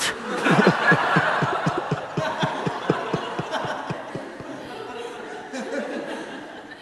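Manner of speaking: long, high, breathy real laughter. Real, not acted. Speaker laughs long after his German punchline, high real laugh. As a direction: laugh helplessly at the joke.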